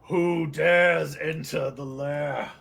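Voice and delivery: slow menacing monster voice; a beholder booming a challenge; hissing reptile growl. Acted.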